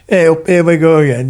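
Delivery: put-on light Cornish accent quote. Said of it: "a Cornish accent".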